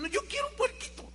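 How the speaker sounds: put-on high, fast, whiny child pleading for a piglet; child's tantrum. Little José begging his dad for a piglet, whiny tantrum. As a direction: whine it like a spoiled kid stamping his feet. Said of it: "Hizo su berrinche".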